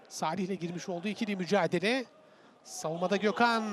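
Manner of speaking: ordinary talk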